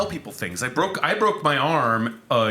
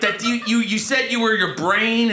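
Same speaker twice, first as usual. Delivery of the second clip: pitched-up, indignant questioning; Marc Maron; 7 semitones higher than usual. Retold or imitated. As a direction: imitate a comic giving a fussy note. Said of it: "and he was like".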